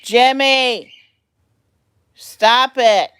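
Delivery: loud sharp call to the pet; scolding the pet. Real, not acted. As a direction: yell at the bird to stop.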